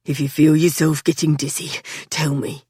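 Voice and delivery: low panting man voice; breathless in the tunnel. A narrator's reading. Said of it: "Patrick panted".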